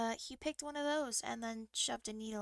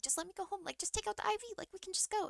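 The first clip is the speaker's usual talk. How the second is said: desperate plea; 8 semitones higher, much faster than usual. Retold or imitated. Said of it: "I'm like".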